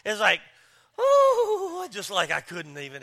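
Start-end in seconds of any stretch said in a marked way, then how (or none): 1.0-1.9 high, loud wail of a cringe; acted high-pitched ooh of someone who can't bear it; pained "ooh". Preacher cringing at the neighbor's yelling, high wailing ooh.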